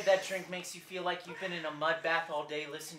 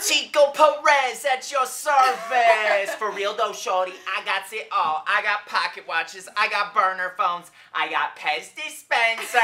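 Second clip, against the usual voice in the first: brash street hustler voice; Chico Perez; 3 semitones higher, 8 dB louder than usual. Acted. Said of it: "working on a character for my reel".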